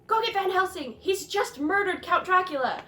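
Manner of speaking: acted panicked shout. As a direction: cry out for help through laughter. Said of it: "he's like".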